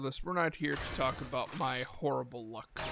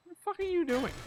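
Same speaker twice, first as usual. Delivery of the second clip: much higher incredulous cry; squeaky disbelief. Real, not acted. Player shocked by a weird zombie, high squeaky disbelief.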